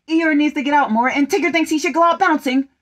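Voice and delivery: fast, giggly read while voices mix up; laughing through it. Real, not acted. Reader laughing at failing to switch voices, fast. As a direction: laugh while rushing the line. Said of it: "I can't switch".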